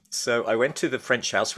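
usual speaking voice

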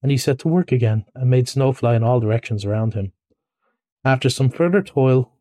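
ordinary delivery, nothing marked

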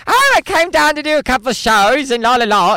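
breezy star shrugging it off; quoting Alan Carr. Retold or imitated. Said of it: "He's like".

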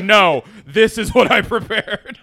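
loud slow laughing protest; laughing insistence. Retold or imitated. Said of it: "I was like".

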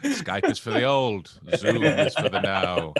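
slow, grave pronouncement; deep dramatic voice. Pretend. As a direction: intone it like a villain's decree. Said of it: "I went really deep with that".

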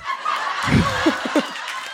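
airy squeal of a laugh; giggling high and breathless; high breathy laugh. Real, not acted. Laughs after joke.

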